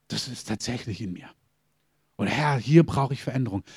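dropped low and soft, as if admitting it to yourself; quiet realisation, almost to yourself; hushed inner thought. Retold or imitated. Inner thought of a convicted believer. (leise, erschrockene innere Stimme)

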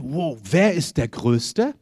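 a hint of wide-eyed disciples bragging; imitating the disciples. Disciples voiced in the retelling. (leicht gespielte, naive Jünger, langsam)

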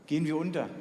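usual speaking voice

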